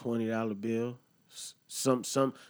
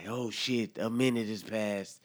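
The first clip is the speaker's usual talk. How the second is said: ordinary talk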